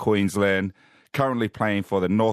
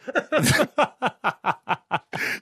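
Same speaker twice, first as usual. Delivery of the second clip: big laugh. Real, not acted. Host laughing after delivering the punchline, delighted. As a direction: crack up at your own joke.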